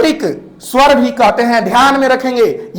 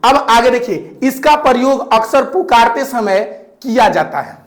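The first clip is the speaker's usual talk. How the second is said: usual speaking voice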